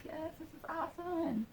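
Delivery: high, soft imitated glee; hushed delight. Retelling grateful thoughts to the woman, hushed delight. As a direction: squeal it softly, high and thankful.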